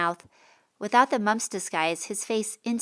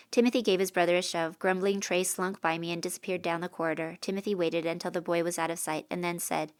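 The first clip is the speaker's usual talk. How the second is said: usual speaking voice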